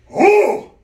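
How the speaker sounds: sudden high, loud yelp of surprise; startled gasp. Retold or imitated. At night camp, startled by a sound in the bushes. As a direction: jump at a noise in the dark. Recreating hearing something at night.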